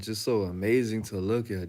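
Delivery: ordinary talk, nothing marked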